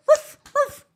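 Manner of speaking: very high, loud yapping imitation; lap dog bark. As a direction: yap like an eager little lap dog. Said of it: "being the lap dog".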